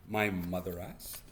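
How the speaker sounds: ordinary delivery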